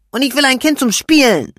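put-on high, loud childish demand; child shouting her wish to her mother; loud demanding cry. A narrator's reading. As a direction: shout it like a stubborn little girl. Lilian shouting on.

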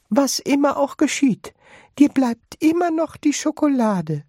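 put-on high old woman, kindly; old grandma voice. A narrator's reading. Grandma Georgina comforts Charlie in a high old voice. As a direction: pitch up into a granny voice.

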